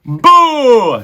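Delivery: loud high drawn-out boo; jeering shout. A narrator's reading. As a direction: boo at the top of your voice. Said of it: "shouted Botticelli".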